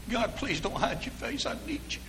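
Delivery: high, soft acted plea; desperate pleading. Fallen believer begging God at the altar, desperate pleading. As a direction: plead softly in a high, broken-hearted prayer. Acts out repentant prayer to God.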